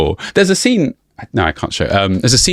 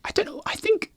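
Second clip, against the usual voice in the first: whisper; 12 dB quieter than usual. Real, not acted. Hesitant aside, whispered.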